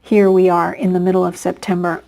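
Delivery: ordinary talk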